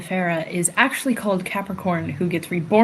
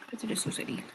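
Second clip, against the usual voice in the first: soft fond whisper; hushed affectionate aside; 5 semitones higher, 13 dB quieter, faster than usual. Real, not acted. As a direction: breathe it out tenderly.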